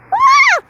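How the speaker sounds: high piercing scream of panic. Real, not acted. Player screaming at the tornado in the game, high panicked shriek. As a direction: shriek as the tornado grabs you.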